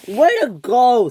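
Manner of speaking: high, loud, sarcastic yell; angry scream. A narrator's reading. Ferdinand to Percy after being washed up, angry scream. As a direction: yell it slowly, blaming. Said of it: "screamed Ferdinand".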